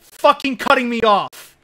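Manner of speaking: furious yelling; angry shout. Real, not acted. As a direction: shout it, fed up and enraged. Raging at cars cutting him off.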